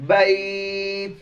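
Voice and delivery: very slow, stretched বাইত; pronunciation demo. Shown as a demonstration. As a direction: hold বাইত long, release an airy t. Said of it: "তো উচ্চারণটা এমন হবে".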